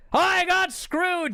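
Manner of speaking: high, loud, drawn-out wrestler complaint; aggrieved shout. Retold or imitated. Wrestler complaining after tipping into a rant, aggrieved high shout. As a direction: shout the grievance slowly. Jarrett's rant continues, quoted.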